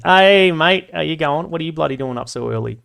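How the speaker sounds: loud, high Aussie hello to a mate; hearty Aussie greeting. Pretend. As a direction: call out to a mate, broad Aussie. Greeting an Aussie viewer in chat.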